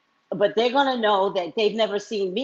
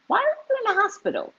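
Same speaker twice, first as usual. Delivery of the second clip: much higher, fast curious question; high mock question; faster than usual. Asking the strange woman at the cab stand, high and fast. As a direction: ask it high and quick.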